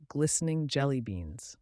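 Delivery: plain reading voice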